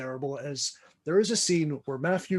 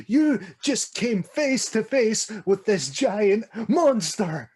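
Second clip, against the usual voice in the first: slow, louder, higher disbelief; incredulous disbelief; 6 semitones higher, 6 dB louder, slower than usual. Retold or imitated. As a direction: spell out the absurdity, slow and loud. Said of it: "all you're thinking of".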